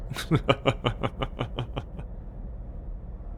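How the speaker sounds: long, soft laugh tailing away; drawn-out quiet laughter; self-satisfied chuckle. Acted. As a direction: chuckle quietly at your own boast. Amused, boasting of own skills.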